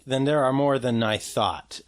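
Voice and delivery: usual speaking voice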